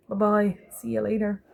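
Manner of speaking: ordinary delivery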